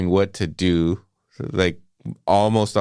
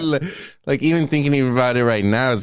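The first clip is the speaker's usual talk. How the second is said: choked up; 5 semitones higher than usual. Real, not acted. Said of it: "getting me a little emotional".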